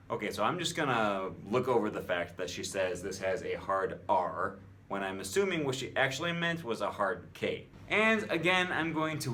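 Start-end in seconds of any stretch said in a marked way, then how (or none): none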